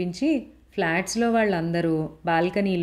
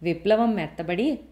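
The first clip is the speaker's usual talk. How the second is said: ordinary delivery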